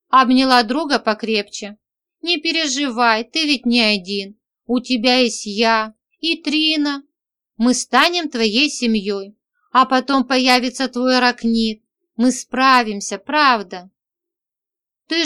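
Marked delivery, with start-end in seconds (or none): none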